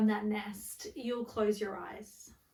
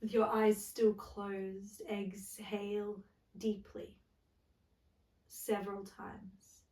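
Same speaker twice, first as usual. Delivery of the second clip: guided meditation. Acted. Yoga teacher settling listeners into the floor, guided meditation.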